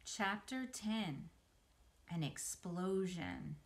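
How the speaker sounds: usual speaking voice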